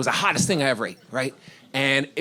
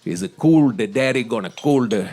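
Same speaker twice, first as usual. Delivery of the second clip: put-on low Indian-accented waiter, dropping lower; imitated Indian accent; 5 semitones lower than usual. Waiter explains the yogurt drink to diner, low Indian accent. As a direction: soothe in a deep mock Indian accent.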